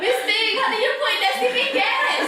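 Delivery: high, laughing and loud. Real, not acted. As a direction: ask through laughter. Laughing mock accusation.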